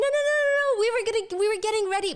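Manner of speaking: high, fast-tumbling panicked protest; squealed, hammed-up objection; mock freak-out. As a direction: imitate flustered girls frantically denying it. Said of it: "the girls are freaking out".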